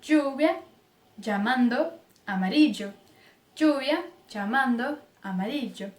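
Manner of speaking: lluvia, llamando, amarillo with ll as dj; dj sound. Shown as a demonstration. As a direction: dj for every ll. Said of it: "o som de D J". (ll com som de dj)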